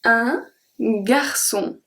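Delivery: "un garçon" said slowly, "ç" as a clear s; slow demo. Shown as a demonstration. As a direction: draw out "un garçon" for learners. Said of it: "We have".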